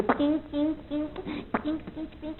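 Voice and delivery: ping each plucked hair with a tiny voice; tweezer pings. Retold or imitated. Imitating tweezers plucking hairs.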